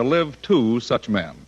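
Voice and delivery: ordinary delivery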